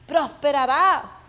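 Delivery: slow, loud "prosperará"; emphatic pulpit proclamation, drawn out; forceful repetition. Real, not acted. Preacher repeats word with force.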